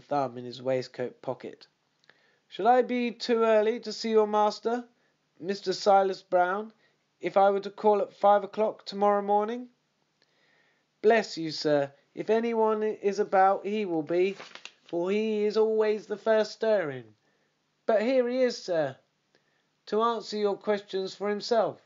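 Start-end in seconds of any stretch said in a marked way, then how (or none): 2.5-9.7 a higher, lightly voiced Holmes, courteous and curious; lightly voiced gentleman detective asking about the master; Holmes, polite inquiry. 11.0-21.8 lightly voiced stable groom, higher and keen to help; a servant pointing out his master, a bit higher; hint of an obliging groom; eager groom.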